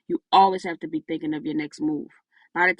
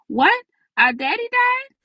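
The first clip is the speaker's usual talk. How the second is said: put-on much higher, loud panicked child's cry; shocked child; 6 dB louder, slower than usual. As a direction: gasp it out as a scared kid. Kids fear their father died.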